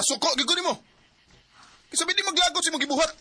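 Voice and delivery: loud, high, fast accusing shout; angry shout. Acted. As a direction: hurl the blame at her. Son confronts mother in anger.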